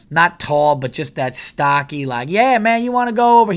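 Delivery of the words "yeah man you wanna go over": hillbilly twang. Retold or imitated. Local hillbilly talking to hikers, mock upstate twang. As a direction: put on a stocky hillbilly's twang. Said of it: "a big rednecky hillbilly guy".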